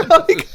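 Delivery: squeaky burst of laughter; high laugh. Real, not acted. Laughing at the memory.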